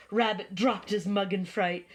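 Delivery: usual speaking voice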